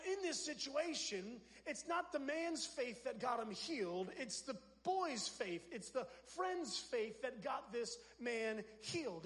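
usual speaking voice

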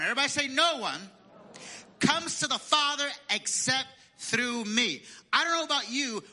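preacher driving the point home; full-force preaching. Acted. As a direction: lift it up and proclaim to the congregation.

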